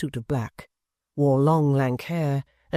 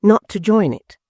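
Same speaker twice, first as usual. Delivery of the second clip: louder, higher protest; crying out; 7 semitones higher, 8 dB louder than usual. A narrator's reading. Mother pleading with Barnaby not to join, crying out. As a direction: cry out to stop him. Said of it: "cried his mother".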